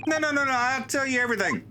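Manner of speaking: high, rapid-fire begging; frantic high-pitched climbdown; panicked pleading. Acted. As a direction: blurt it out in hammed-up panic. Threatened figure begs to confess.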